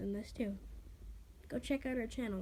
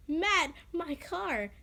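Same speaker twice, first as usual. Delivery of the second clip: mild mock scream; 5 semitones higher, much slower than usual. A narrator's reading. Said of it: "Sky screamed".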